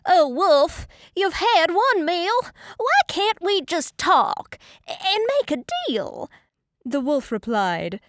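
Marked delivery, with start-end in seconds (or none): 0.1-6.3 high, loud pleading pig; squealing cry. A narrator's reading. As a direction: plead with the wolf in a raised squeal. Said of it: "The little pig began to squeal. He cried".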